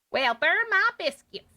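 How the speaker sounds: high, slow Southern lady drawl; a hammed-up Southern lady exclaiming; Southern belle. Passenger lady in the taxi, Southern belle voice. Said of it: "translate as Southern".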